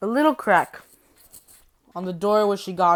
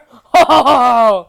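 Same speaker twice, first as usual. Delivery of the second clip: big, loud, higher villain laugh; 4 semitones higher, 13 dB louder than usual. Acted. Villain relishing ways to kill the girl, villain laugh. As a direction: burst into a loud gloating laugh. Voicing villain's gleeful threat.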